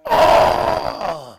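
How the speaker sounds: loud open-throated imitated roar; tiger roar. Tiger standing on the official's chest, roaring. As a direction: roar over pinned prey.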